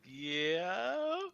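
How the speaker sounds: small, high, rising answer; sheepish. Acted. Clerk caught by his boss with the letter, sheepish. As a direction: admit it timidly, as a question.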